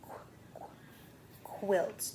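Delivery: "Qu, qu, quilt" with a k-w burst before the word; sounding out qu. Shown as a demonstration. Teacher breaking quilt into sounds for a child, sounding out. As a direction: stretch the opening qu, then say quilt. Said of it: "It's called a quilt".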